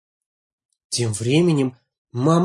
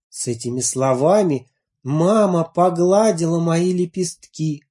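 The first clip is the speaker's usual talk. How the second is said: plain reading voice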